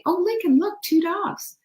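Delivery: high, sing-song voice to a dog; talking to the dog. Retold or imitated. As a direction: point out the dogs to your pup, high and sweet.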